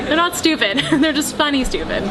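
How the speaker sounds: real laughter through the words; giggling as she corrects herself; laughing while talking. Real, not acted.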